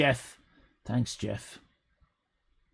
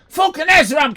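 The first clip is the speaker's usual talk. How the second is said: mock angry shout; 8 semitones higher, 5 dB louder than usual. Real, not acted. Streamer shouting a viewer's name in mock rage. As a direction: yell the name in hammed-up outrage.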